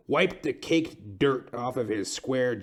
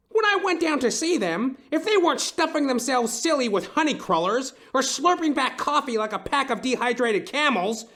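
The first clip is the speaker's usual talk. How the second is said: indignant rant; 11 semitones higher than usual. Acted. Client ranting to the detective about police, indignant. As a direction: spit out contempt for the cops.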